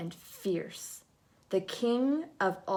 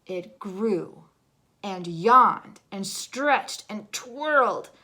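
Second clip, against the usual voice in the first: growing bigger; 3 semitones higher, 11 dB louder than usual. A narrator's reading. Narrator building as yellow grows huge, much louder. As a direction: let the voice swell with the color. Color swelling to giant size.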